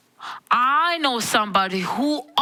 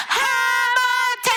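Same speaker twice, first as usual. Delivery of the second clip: loud calling shout; 10 semitones higher, much slower than usual. Acted. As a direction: shout the name out long and slow. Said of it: "even louder this time".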